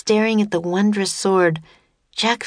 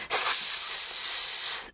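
long sustained hiss; impatient serpent hiss; 6 dB quieter than usual. A narrator's reading. Serpent waiting for an answer, hissing impatiently. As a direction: hiss steadily to hurry them along. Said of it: "the serpent hissed at them impatiently".

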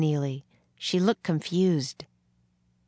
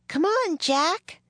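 high-pitched call from outside; 14 semitones higher, slower than usual. A narrator's reading. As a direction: call to a friend to come along. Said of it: "Annie called outside the hut".

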